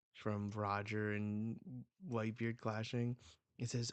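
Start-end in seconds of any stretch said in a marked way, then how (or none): none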